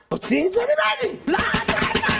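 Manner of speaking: high, escalating shriek; mock panicked refusal. Acts out refusing money, escalating. (סירוב היסטרי בצווחה)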